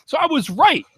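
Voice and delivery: really fired up and venting; real heated outrage, voice climbing high; heated rant. Real, not acted. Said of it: "I started to get a little heated".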